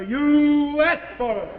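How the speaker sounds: loud, high, stretched-out warning; slow it down and push it up loud; drawn-out warning. Acted. Husband warns Norton before the blow, loud warning.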